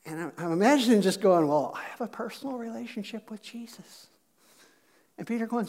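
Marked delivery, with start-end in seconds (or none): 1.5-4.1 soft, understated pious answer; mock-demure, hushed reply; meek churchgoer. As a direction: offer it quietly, as a modest little claim.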